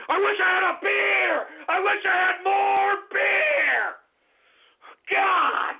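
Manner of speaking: shouting. Acted. Outburst craving beer.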